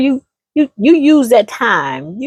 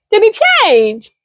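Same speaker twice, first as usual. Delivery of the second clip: incredulous friend; 12 semitones higher than usual. Acted. Nosy friend demanding answers, high and shocked. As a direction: grill her in disbelief.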